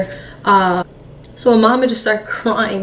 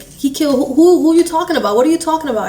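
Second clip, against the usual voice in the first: higher-pitched, baffled questions tripping over each other; confused; 6 semitones higher, faster than usual. Retold or imitated. Mom on the phone with her sister, confused. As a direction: imitate someone stammering in confusion. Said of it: "my mom's confused".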